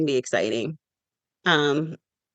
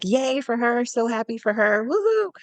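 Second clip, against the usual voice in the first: mock cheer; 8 semitones higher than usual. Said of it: "it's like".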